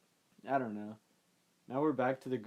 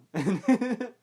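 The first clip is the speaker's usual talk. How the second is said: loud high laugh; 10 semitones higher, 10 dB louder than usual. Retold or imitated. After the kiss joke.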